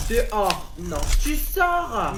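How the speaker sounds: angry order. Acted. Teacher ordering student out.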